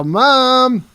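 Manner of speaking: put-on high, loud kid-like holler; calling for Mom. Teenager calling his mother, excited holler. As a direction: call out to Mom across the house.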